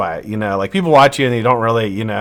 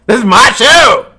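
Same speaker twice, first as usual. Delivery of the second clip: louder, higher, hammed-up protest; mock indignant; 11 semitones higher, 8 dB louder than usual. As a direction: pretend outrage at being ignored. Demands attention from chat.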